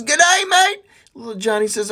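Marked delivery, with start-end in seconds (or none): 0.0-0.8 high, loud Aussie greeting; Australian accent. Acted. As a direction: answer like a cheery Australian mate. Australian coast guard answers phone.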